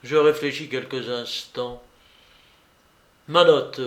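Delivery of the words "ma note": louder, abrupt call for the bill; sudden cry. Retold or imitated. Narrator suddenly calling for his hotel bill. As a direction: blurt it out suddenly. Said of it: "m'écriai-je tout à coup".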